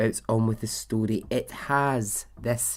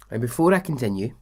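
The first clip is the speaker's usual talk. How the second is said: ordinary talk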